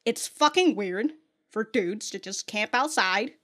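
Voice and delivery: ordinary delivery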